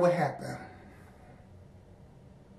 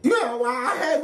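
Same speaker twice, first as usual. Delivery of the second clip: laughing; 7 semitones higher than usual. Real, not acted. Laughing while confessing.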